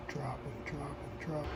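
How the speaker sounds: fading away. Real, not acted. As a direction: let it sink to a whisper. Temperature falling, voice fading.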